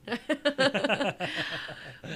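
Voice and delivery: long, amused laugh. Real, not acted. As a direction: burst of real laughter. Laughs after listener's consoling remark.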